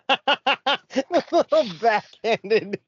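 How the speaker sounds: high-pitched, laughing through the words; helpless amusement, voice lifted high; real laughing. Real, not acted. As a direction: crack up while repeating the jab. Listener cracking up at the jab.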